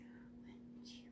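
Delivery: breathy whispered counting; whispering. Real, not acted. Counting while trying not to talk.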